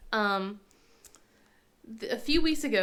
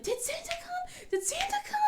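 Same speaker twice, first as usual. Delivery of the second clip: put-on much higher, softer child voice; eager kids; 7 dB quieter than usual. Kids asking mom about Santa, high eager voice. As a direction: ask it like a hopeful little kid. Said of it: "And then, like".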